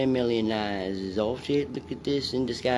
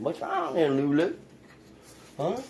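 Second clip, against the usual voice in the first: higher concerned coo; pet voice; 5 semitones higher than usual. Real, not acted. Asking Lulu what's wrong in a raised pet voice. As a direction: ask the dog gently, higher.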